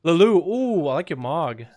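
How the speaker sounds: normal talking voice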